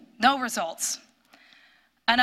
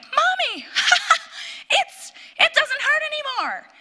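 put-on much higher childlike voice, laughing through tears; little girl laughing; 4 dB louder than usual. Daughter tells mommy the pain is gone, laughing. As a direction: play the relieved six-year-old giggling.

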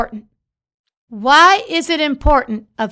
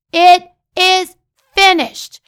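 proclaimed loud; 4 semitones higher, 4 dB louder than usual. Acted. Preacher proclaims the three words.

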